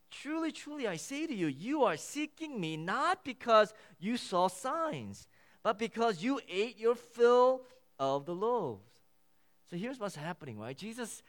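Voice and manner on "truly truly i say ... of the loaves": scripture reading. Acted. Preacher reading the Gospel aloud to his congregation, deliberate. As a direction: read the verses out with weight to the room.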